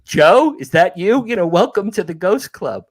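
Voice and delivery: high, loud mock surprise at recognizing someone; playful ghost greeting. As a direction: play a ghost hailing a newcomer. Voices a ghost greeting another ghost.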